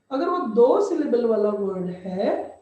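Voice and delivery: ordinary delivery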